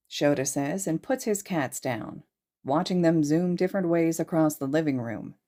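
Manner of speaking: ordinary delivery, nothing marked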